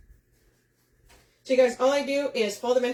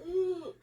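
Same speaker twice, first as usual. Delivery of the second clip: mock squeal; 8 semitones higher, 6 dB quieter than usual. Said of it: "I'm like".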